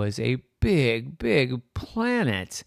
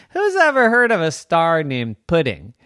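loud, higher-pitched incredulous scoff; mocking; 5 semitones higher, 6 dB louder than usual. Planet laughs at a star named Pudding, mocking. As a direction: scoff in amused disbelief.

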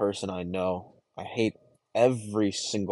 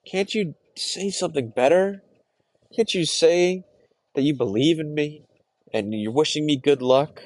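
much higher, louder pleading cry; desperate plea; 7 semitones higher, 5 dB louder than usual. Acted. Begging his family for real encouragement, a high desperate plea. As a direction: beg them to say they believe in you. Pleading with whoever doubts him.

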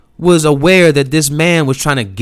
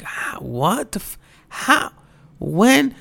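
soft, halting questions jumping high; imitated stunned disbelief; 10 semitones higher, 4 dB quieter, slower than usual. Mom hearing her child got hit by a car, imitated shock. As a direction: act out a mom too stunned to finish.

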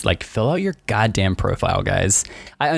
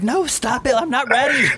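high, squeaky mock panic. To the dating app grabbing his photos, high mock panic. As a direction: pretend to squeal in alarm.

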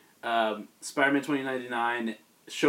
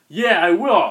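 eager yes; 6 semitones higher, 11 dB louder, slower than usual. Retold or imitated. Uncle Ben eagerly agrees.

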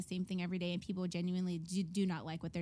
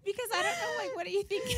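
much higher, squeaky voice; squealing disbelief; 3 dB louder than usual. Real, not acted. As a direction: shriek out how crazy it sounds. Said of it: "that actually terrifies me".

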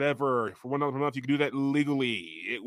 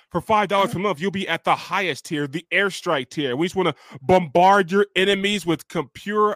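ad read; 4 semitones higher, 4 dB louder than usual. Acted. Ad read top tier.

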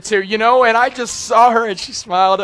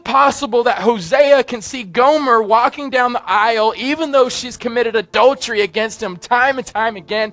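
louder, higher, urgent preaching; preaching at full force; 5 semitones higher, 5 dB louder than usual. Acted. Pastor pleading with congregation, full-force preaching. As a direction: press the question on the congregation.

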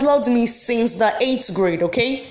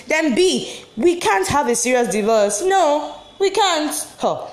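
ordinary talk, nothing marked

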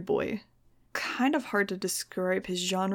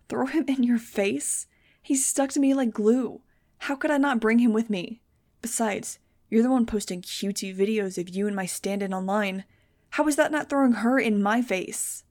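furious; 2 semitones higher, 4 dB louder than usual. Acted. Max hurling accusations back at Fang, furious. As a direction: fire his words back at him. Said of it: "more furious with anyone".

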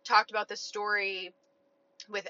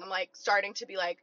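ordinary delivery

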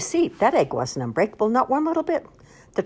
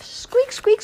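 very high, louder squeaking mice; mouse squeaks; 6 dB louder than usual. Acted. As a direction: squeak like mice running away. Mice scurrying off.

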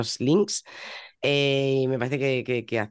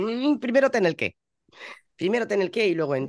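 higher, knowing advice to a beginner; mock advice; 8 semitones higher than usual. Host giving advice to aspiring podcasters, mock knowing tone. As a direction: spell it out like a wise mentor.

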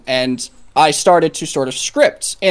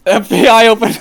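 repeating the line through laughter; laughing echo; 7 semitones higher, 6 dB louder than usual. Pretend. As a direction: laugh while echoing the joke. Echoes host's FBI joke.

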